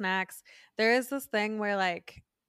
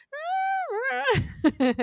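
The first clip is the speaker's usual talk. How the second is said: high whiny squeal; 4 dB louder than usual. Retold or imitated. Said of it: "We just go".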